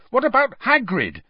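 Harry angrily defending his friend to Parvati. A narrator's reading. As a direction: challenge her, hot and sharp. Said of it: "Harry said angrily".

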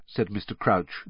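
ordinary delivery

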